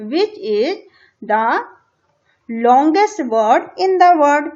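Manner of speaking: reading English instructions slowly, word by word; slow textbook reading. Shown as a demonstration. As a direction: read it out deliberately for the class. (किताब से धीरे-धीरे पढ़ना)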